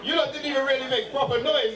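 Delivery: ordinary delivery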